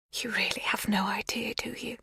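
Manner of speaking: hushed, knowing whisper. A narrator's reading. Magda whispering a secret to Lexi. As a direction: breathe it like a secret.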